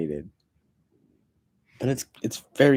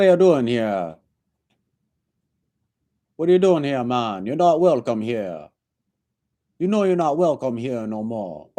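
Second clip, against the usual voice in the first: put-on Jamaican accent; 4 semitones higher than usual. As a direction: play a Rasta barman throwing someone out. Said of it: "I wish I talked like that".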